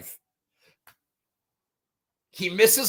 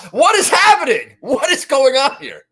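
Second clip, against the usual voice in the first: baffled disbelief; 7 semitones higher, 4 dB louder than usual. Retold or imitated. Retelling a baffling scene.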